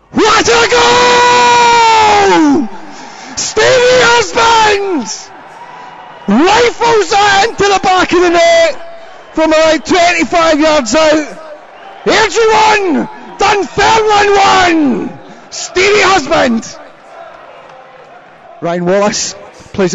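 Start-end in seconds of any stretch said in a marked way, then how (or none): 0.1-2.7 long, high, slow goal roar; long goal yell. Real, not acted. Home goal, commentator erupts. 3.4-16.8 excited goal call. Real, not acted. Goal celebration in live commentary.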